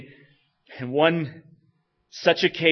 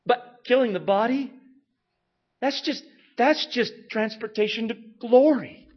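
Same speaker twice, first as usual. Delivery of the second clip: impassioned preaching; 10 semitones higher, 5 dB louder than usual. Acted. Preaching at full force.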